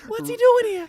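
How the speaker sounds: squeaky high cop; a squeaky, cartoonish cop; Mickey Mouse cop voice. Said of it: "It's so Mickey Mouse-ish".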